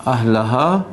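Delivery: slow, drawn-out Quranic recitation. Acted. Teacher recites the verse to the class, slow and measured. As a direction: recite each word slowly and carefully.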